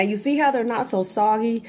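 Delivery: normal talking voice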